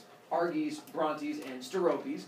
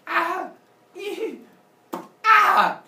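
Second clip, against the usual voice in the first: loud high yelps; high loud mock agony; pained screams; 11 dB louder, much slower than usual. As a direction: scream in repeated pain. Father Sky in pain.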